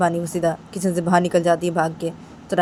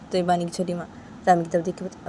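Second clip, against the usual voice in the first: plain reading voice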